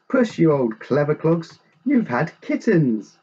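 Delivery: high, proud storybook praise; delighted exclamation. A narrator's reading. Grandpa finds his cat has kittens, delighted exclamation. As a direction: exclaim it with pleased amazement.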